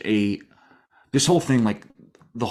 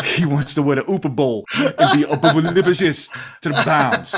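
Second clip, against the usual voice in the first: laughing; 4 semitones higher, 4 dB louder than usual. Real, not acted.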